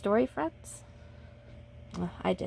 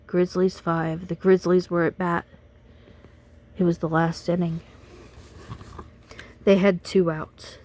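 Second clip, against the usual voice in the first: put-on lively read-aloud for kids; animated storyteller voice for children; storybook reading; 4 dB louder than usual. A narrator's reading. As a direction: read it like bedtime story time. Reads picture book to children.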